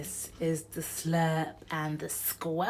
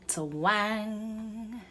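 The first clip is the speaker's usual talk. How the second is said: usual speaking voice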